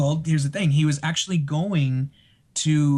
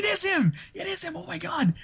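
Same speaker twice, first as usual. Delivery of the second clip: much higher, thrilled; telling friend Carrie it's really him; starstruck excitement; 3 dB quieter than usual. Retold or imitated. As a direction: squeal it to your friend.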